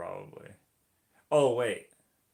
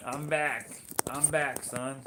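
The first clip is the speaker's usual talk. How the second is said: loud, higher triumphant announcement; announcing a return; 4 semitones higher, 5 dB louder, slower than usual. Real, not acted. Streamer returning to the viewers, loud announcing. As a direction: call out that you're back.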